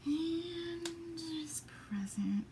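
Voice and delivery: breathy unvoiced half-whisper to herself. Real, not acted. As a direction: mutter it under her breath. Muttering while choosing stamp.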